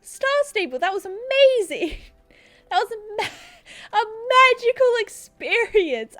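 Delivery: high, loud and amazed; excited. Real, not acted. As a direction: gasp in delight at the view. Thrilled gasp at new graphics.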